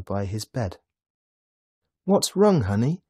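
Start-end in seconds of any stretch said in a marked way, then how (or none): 2.0-3.0 high, lilting motherly question; concerned mother asking. A narrator's reading. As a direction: ask a child tenderly what's the matter. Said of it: "she asked".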